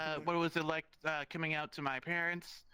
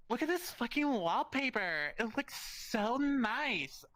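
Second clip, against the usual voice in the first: higher, dreamy voice for a friend admiring wallpaper; mock swooning over wallpaper, slowing down; imitating friend; 7 semitones higher, slower than usual.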